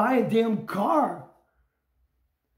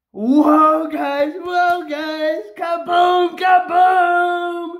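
excited; much slower than usual. Real, not acted. Player celebrates a minimum win to viewers, excited hollering. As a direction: whoop it to the viewers, words held long. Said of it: "sorry for the excitement".

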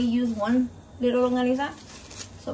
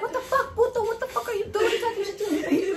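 high-pitched rant edged with laughter; retold indignant scolding; 8 semitones higher than usual. Mother retelling her rant at the cat, indignant and amused.

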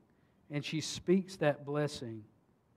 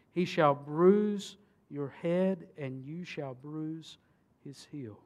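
slow solemn reading; slower than usual. Acted.

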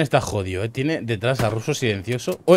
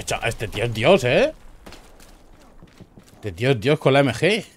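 ordinary talk, nothing marked

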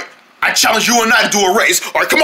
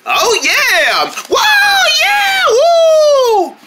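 excited cheer; much slower than usual. Acted. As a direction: cheer like a superfan. Cheering the fast racer.

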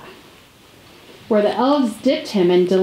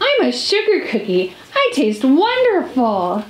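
offended cookie; 3 semitones higher than usual. Acted. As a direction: protest that you taste wonderful.

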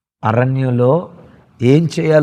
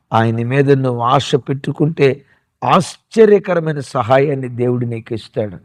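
usual speaking voice

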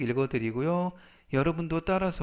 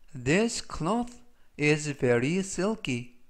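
very slow, deliberate sentence; slow reading for repetition; much slower than usual. Shown as a demonstration. Teacher reading slowly so students repeat. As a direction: read it out word by word. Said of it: "제가 역시 천천히 세 번씩 읽어드리고요".

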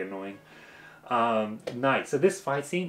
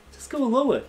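much higher, louder frustrated call; exasperated urging; 13 semitones higher, 4 dB louder than usual. Real, not acted. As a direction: yell advice at the screen. Urging a fighter on screen.

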